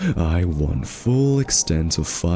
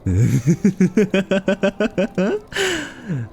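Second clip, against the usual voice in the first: mocking laugh. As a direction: cackle at him in triumph. Mocking detective's practice.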